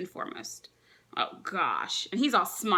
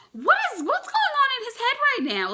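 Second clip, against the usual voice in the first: much higher and louder, held out; baffled; 8 dB louder than usual. Real, not acted. Reader exasperated at Luffy staying put, high baffled cry. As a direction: exclaim in exasperated disbelief.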